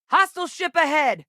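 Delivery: high, loud alarm call; sudden alert from the cockpit; urgent warning. Acted. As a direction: shout it to the whole crew. Warning crew of enemy.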